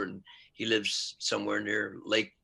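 ordinary talk

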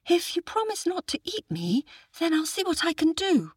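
put-on very high child voice; small squeaky-voiced lad, agreeing; little boy. A narrator's reading. Boy agrees to help the tiger, high child voice. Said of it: "said the boy".

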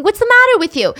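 high-pitched, mild mock scream; retold scream. Upset girl protests being grabbed, mock screaming. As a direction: act out her outrage without full volume. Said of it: "she started screaming".

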